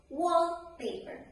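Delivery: "wallpaper" with WALL pitched high, paper falling away; stress on first noun. Shown as a demonstration. Teacher modelling compound noun stress, stress on WALL in "wallpaper". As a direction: put the weight on "wall" in "wallpaper". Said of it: "put the stress on the first noun".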